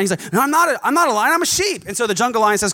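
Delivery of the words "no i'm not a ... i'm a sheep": higher and louder, frantic denial; the cub refuses, squeaky and loud; protesting cub. Acted.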